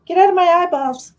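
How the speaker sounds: high, loud cry at the cotton; loud complaint. Real, not acted. Vlogger yelling at cotton in her eyes, loud and high. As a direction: yell at the stinging fluff.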